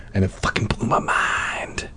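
intense whisper; hushed awe. Acted. Host recalls tripping at the movie, awed whisper. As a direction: whisper it like a mind-bending revelation. Mock-awed punchline of his anecdote.

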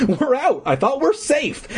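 high, excited relief; high excited cry of escape; relieved cry. Acted. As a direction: burst out thinking you've escaped. Narrator voices relieved kid.

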